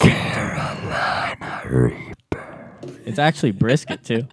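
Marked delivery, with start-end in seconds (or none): 0.0-2.7 announcer growl. Pretend. Hyped whispered-shout product name.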